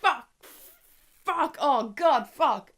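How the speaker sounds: loud, drawn-out horrified cursing; panicked swearing. Retold or imitated. Reviewer reliving a gory dog scene, loud panicked cursing. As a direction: swear over and over, recoiling in horror. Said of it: "I was just going".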